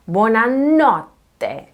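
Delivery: BUONANOTTE leaning on NOT; second-to-last syllable stress. Shown as a demonstration. Teacher giving second example, stress on NOT in buonanotte. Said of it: "stressed on the second to last syllable".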